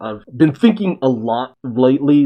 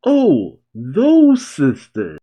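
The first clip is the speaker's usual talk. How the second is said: gleeful recollection; 5 semitones higher, much slower than usual. Acted. Crow recalls the sisters.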